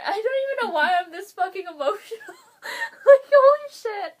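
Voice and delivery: high, loud crying through laughter; real tearful overwhelm. Real, not acted. Fan overwhelmed after the song, real tearful outburst. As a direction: voice climbing as tears and laughs take over. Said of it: "I'm just super fucking emotional".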